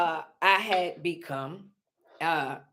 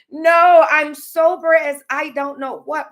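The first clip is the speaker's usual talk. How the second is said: ordinary talk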